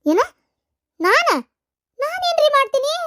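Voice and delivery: high, indignant cry of disbelief; as if struck by the accusation; shocked disbelief. Acted. Wife accused of harming children. (ಬೆಚ್ಚಿ ಏರಿದ ಧ್ವನಿಯ ಆಶ್ಚರ್ಯ)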